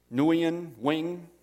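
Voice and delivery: "Nguyen" in two syllables, then "Wing" in one; variant name pronunciations. Shown as a demonstration. Pastor trying name variants aloud, "Nguyen" then one-syllable "Wing". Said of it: "I've heard it pronounced different".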